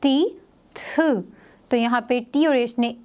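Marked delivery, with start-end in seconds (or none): 0.0-1.2 ती then थ, drawn out slowly; teacher models the th sound slowly. Shown as a demonstration. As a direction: say ती and then थ as a slow breathy th sound. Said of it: "sound produce करेंगे थ की".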